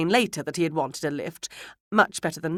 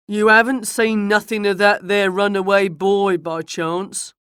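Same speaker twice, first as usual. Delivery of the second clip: loud rustic villager; Joe; 3 semitones higher, 8 dB louder than usual. A narrator's reading. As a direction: ask it in a loud country voice. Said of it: "said a new voice. Joe's, no doubt".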